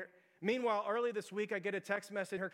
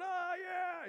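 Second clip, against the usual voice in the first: high, drawn-out party cheer; mock crowd cheer; 10 semitones higher than usual. Guests cheering as the blindfold comes off, mock cheer. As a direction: imitate a crowd's rising "oh yeah". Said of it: "everybody's going".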